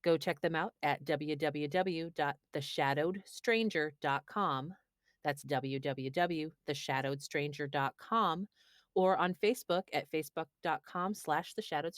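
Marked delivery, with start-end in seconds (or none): none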